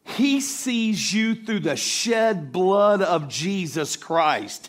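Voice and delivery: slow, high, loud proclamation; full-force preaching. Real, not acted. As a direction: proclaim it with rising force to the congregation.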